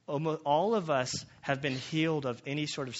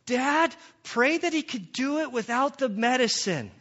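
put-on much higher, louder child's voice with a laugh; imitating little daughter; 11 semitones higher, 7 dB louder than usual. Father imitating his daughter interrupting his prayer, high childish plea. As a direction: pipe up like an eager little girl correcting dad.

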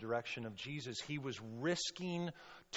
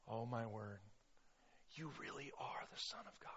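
soft, stunned near-whisper; hushed awe; 2 semitones lower, 13 dB quieter than usual. Acted. Preacher as awestruck disciples to Jesus, hushed awe. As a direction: breathe it out in amazement. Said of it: "they're just like".

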